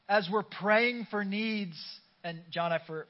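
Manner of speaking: ordinary talk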